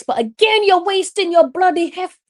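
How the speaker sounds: high, loud frustrated outburst; exasperated yell. Real, not acted. Commentator yelling at a player who wasted a header, high and loud. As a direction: shout at the wasted header in real disbelief.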